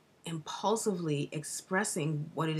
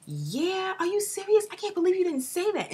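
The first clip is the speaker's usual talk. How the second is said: much higher, louder, rushing with delight; excited disbelief; 13 semitones higher, 3 dB louder than usual. Acted. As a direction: squeal it out like you can't believe your luck. Acted excited reply to partner.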